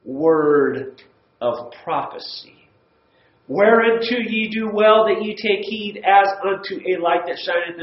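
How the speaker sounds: scripture reading. Acted. Teacher reads the class Second Peter with solemn weight. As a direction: read the verses with weight, slowing at the start.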